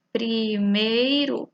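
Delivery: slow, syllable-clear; slow word demo. Shown as a demonstration. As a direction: spell out Primeiro slowly.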